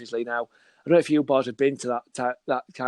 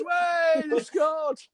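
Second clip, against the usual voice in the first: high, shouted horn-like cheer; train horn celebrating; 14 semitones higher, much slower than usual. Retold or imitated. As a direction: honk out a goal celebration. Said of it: "when the goal goes in".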